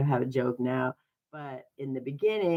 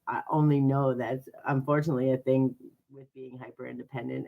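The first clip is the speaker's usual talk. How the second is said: ordinary talk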